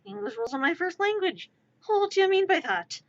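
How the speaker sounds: ordinary talk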